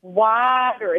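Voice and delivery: put-on water said slowly in a Minnesota accent, as wah-der with a strong r; a careful learner copying a Minnesota accent. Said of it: "full-on Minnesota accent".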